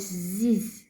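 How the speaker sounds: "Z" as a low buzz; voiced Z. Shown as a demonstration. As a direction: buzz "Z" with vocal cords on. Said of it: "Z has voice".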